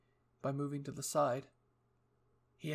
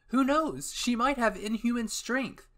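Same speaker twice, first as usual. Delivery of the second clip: excited; 9 semitones higher, 8 dB louder, slower than usual. Acted. Hanjay excited speculation.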